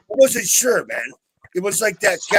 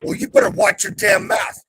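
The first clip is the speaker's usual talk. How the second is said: louder, a hammed-up threat; mock angry shout; 5 dB louder than usual. Answering Boom's chump insult with a hammed-up angry shout. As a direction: bark it back at the insult.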